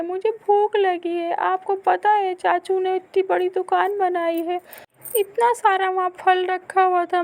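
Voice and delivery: put-on high, loud complaining child; hurt little boy. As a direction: run to mother with the hurt. Boy whines for food. (माँ से ऊँची आवाज़ में शिकायत करता बच्चा)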